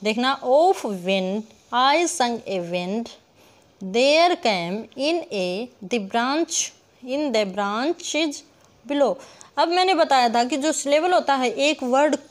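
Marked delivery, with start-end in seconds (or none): 0.4-9.1 very slow, measured verse in separate beats; syllable-by-syllable scansion. Shown as a demonstration. Teacher reads heptameter for counting, slowly syllable by syllable. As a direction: space out every syllable of the line. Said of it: "जितने हम टुकड़ों में pronounce करेंगे".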